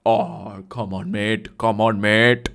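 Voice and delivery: cajoling imitation with an Indian accent. Buyer coaxing agent who refused, Indian accent. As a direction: coax him in a put-on Indian accent. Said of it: "spot on impression".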